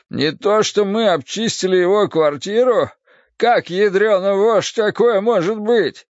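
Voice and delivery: high, pained protest; distressed disbelief. Acted. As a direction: question it in agitated bafflement. Poisoned man argues in disbelief.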